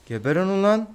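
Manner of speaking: high, loud angry yell. Real, not acted. Gamer yelling at enemies to die, angry yell. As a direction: bark it at the enemies.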